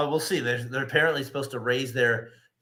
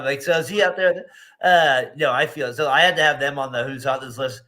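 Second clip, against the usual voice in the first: normal talking voice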